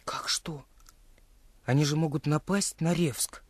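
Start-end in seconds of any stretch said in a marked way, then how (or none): 0.0-0.6 urgent little whisper. Acted. Genka presses Misha about the bandits, whispering. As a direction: hiss the question under your breath.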